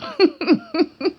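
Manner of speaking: high, loud burst of laughter; sudden laugh. Real, not acted. Laughing while recalling being tied up, loud and high. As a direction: crack up mid-story. Laughs at own grim memory.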